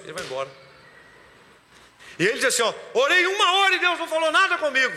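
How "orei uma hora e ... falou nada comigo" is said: high, fast indignant whine; raised-pitch rushed grumbling; mock complaint. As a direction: imitate a put-out believer complaining. Mimicking a complaining pray-er.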